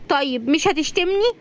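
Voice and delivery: high, small-voiced question; high female-character plea; childlike pleading. Razan hiding, asks for reassurance.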